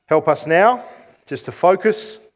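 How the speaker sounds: soft, low prayer voice. Real, not acted. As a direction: pray quietly and reverently. Opening prayer.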